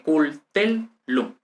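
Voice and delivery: "cul, tel, lum" in separate syllables, weight on tel; three detached syllables, cul, TEL, lum; stress on TEL. Shown as a demonstration. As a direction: hit "tel" harder, let "lum" fall. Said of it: "la sílaba tónica estará aquí".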